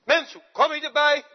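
loud, high, scornful retort; indignant denial. Acted. Peter brushing off the accusing maid, loud indignant.

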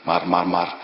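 usual speaking voice

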